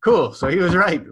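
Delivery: high, loud pleased voice; elated. Retold or imitated. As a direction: savour the win. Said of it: "and I was like".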